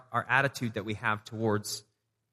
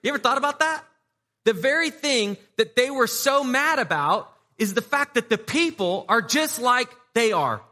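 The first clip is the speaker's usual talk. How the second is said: full-force preaching; 12 semitones higher, 7 dB louder than usual. Acted. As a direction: drive the point home to the congregation.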